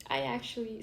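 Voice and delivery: usual speaking voice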